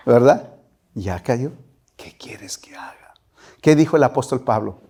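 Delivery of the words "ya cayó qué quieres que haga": barely voiced, surrendered whisper; humbled whisper. Preacher voicing a humbled believer to God, whisper. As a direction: breathe the words out in surrender.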